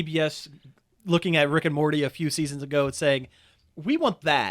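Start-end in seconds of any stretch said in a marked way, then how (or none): 3.8-4.5 executive voice. Pretend. Voicing TBS executives.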